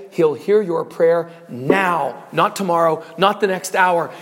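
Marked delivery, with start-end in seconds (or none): none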